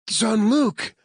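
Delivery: a high, loud cry of the name Jean-Luc; alarmed cry. Acted. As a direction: call out Jean-Luc in sudden fear. Claire cries out his name.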